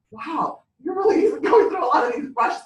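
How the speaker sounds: high, amused mock astonishment; amazed shop girl. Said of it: "and the girl was".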